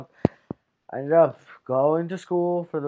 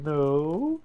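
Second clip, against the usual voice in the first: "No" sliding up into a high pitch; voice going up high; 2 semitones higher than usual. Retold or imitated. Boy denies it to classmates, voice going up high. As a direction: let the voice climb as it tails off. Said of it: "my voice went up high".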